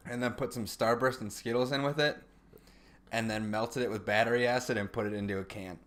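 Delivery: ordinary delivery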